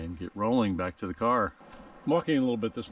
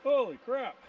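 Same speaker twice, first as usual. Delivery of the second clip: high, stunned outburst; shocked exclamation. Real, not acted. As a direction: let it burst out in disbelief. Shocked after rugged descent.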